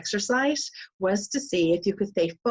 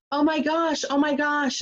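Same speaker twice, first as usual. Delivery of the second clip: panicky; 7 semitones higher than usual. Retold or imitated. Sandy scared at zip line.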